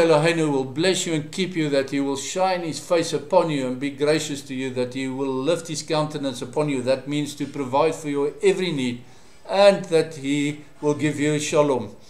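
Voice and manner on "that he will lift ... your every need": ordinary delivery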